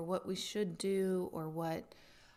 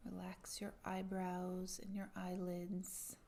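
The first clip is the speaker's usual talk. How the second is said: guided meditation; 8 dB quieter than usual. Acted.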